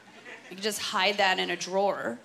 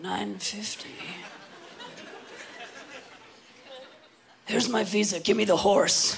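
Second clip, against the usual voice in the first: sad resigned customer. Retold or imitated. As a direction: mumble it like a defeated shopper. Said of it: "She talks like this".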